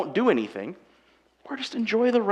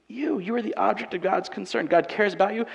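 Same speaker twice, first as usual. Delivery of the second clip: ordinary delivery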